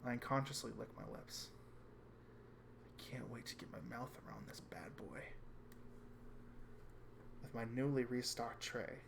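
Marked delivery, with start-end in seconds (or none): 3.0-5.2 soft unvoiced hungry whisper. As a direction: whisper it to yourself. Narrator's private craving.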